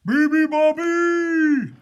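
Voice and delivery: slow, high, loud yell; drawn-out animal holler. A narrator's reading. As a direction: yell it out long like a hippo. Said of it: "yelled the hippos".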